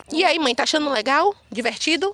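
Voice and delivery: high, loud mocking questions; teasing questions. Real, not acted. Husband teases his wife about enjoying the forest, mocking. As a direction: needle her playfully.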